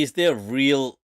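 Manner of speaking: usual speaking voice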